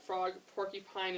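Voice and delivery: plain reading voice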